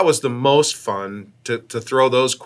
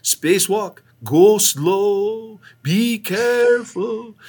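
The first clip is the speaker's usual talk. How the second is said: high, stretched-out mock warning; slow singsong caution, pitched up; imitated Russian cosmonaut; 8 semitones higher, much slower than usual. Russian crewmate floats back to warn astronaut, slow high imitation. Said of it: "He'd go".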